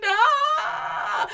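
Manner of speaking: long, high, loud wail; acted scream while running away; fleeing scream. Bitten giant running from the baby, screaming.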